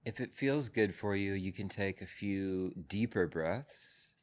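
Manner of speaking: calm, measured voice with long pauses; guided meditation. Real, not acted. Teacher settles a group into breath and body, guided meditation.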